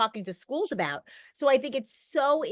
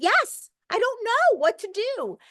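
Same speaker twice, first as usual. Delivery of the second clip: desperate plea; 11 semitones higher than usual. Retold or imitated. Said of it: "And I said".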